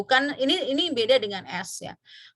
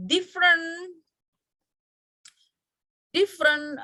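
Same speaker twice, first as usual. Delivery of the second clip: different said high and stressed; emphatic; 8 semitones higher, slower than usual. Shown as a demonstration. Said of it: "consonant will create different meaning".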